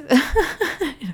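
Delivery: high, loud burst of laughter; real laugh. Real, not acted. Speaker amused at calling the little cousin names, bursting into laughter. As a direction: burst out laughing at your own naughty remark.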